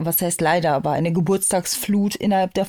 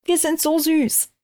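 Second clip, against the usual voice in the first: gushing over cuteness; 7 semitones higher than usual. Real, not acted. Gushing over sped-up voices.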